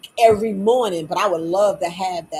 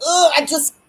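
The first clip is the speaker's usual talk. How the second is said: high, louder exasperated ugh; fed-up groan; 7 semitones higher, 5 dB louder than usual. Retold or imitated. Admitting to herself she cannot do it, high fed-up ugh. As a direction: sound done with it all.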